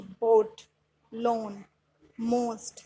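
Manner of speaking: बोट, लोन, मोस्ट one at a time with long o; long O sound. Shown as a demonstration. Teacher giving examples of o-a, long o in बोट, लोन, मोस्ट. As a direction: hold the o in बोट, लोन, मोस्ट, pausing between. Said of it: "sound है ओ ए की".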